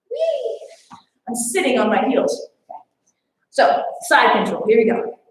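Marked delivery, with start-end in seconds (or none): 0.1-0.6 high playful whee. Pretend. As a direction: toss off a squeaky wee.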